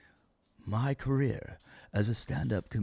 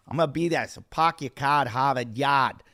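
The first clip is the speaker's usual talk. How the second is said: hammed-up Boston accent with dropped r's; terrible Boston accent. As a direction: do a bad Boston townie accent. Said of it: "terrible Boston accent".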